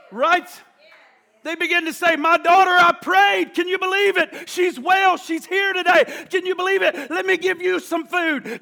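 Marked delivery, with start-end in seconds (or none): 2.2-8.6 high, excited proclaiming; acted-out elation over answered prayer; joyful testimony. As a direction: share amazing news with the whole crowd. Acts out overjoyed testimony of answered prayer.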